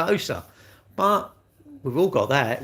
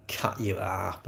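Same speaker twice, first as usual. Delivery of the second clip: muttered aside; 6 semitones lower, 8 dB quieter, much slower than usual. Real, not acted. Grumbling about the modern world.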